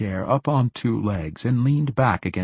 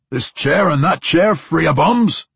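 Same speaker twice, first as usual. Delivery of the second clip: loud, higher rough dwarf voice; gruff dwarf voice; 9 semitones higher, 9 dB louder than usual. A narrator's reading. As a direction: bellow it like a rowdy dwarf. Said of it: "a gruff voice asked".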